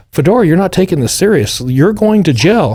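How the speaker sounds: stern agents. Retold or imitated. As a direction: lay down the law to him. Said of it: "they're like".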